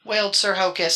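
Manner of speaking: plain reading voice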